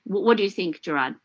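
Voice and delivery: normal talking voice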